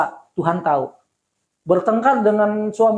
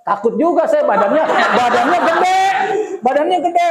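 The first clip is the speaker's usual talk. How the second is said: laughing, pitch climbing high; 8 semitones higher than usual. Real, not acted. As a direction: laugh it out at the memory. Laughs recalling the big husband. (tertawa geli, nada tinggi)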